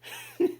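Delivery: short high laugh; a high giggle after cursing out a character; burst of laughter. Real, not acted. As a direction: break into a quick squeaky laugh. Laughs at own rant.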